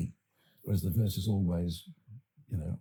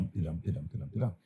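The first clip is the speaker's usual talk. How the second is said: "da-dum" with a soft da and a stressed dum, a little softer; iambic da-dum rhythm; 4 dB quieter, much faster than usual. Shown as a demonstration. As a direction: tap out the iambic beat in "da-dum, da-dum". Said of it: "attic pentameter".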